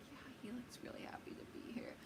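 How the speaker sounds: hushed whisper to a sister; voiceless, secretive whisper; whispered aside. Retold or imitated. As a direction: whisper it under your breath. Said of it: "I whispered to my sister".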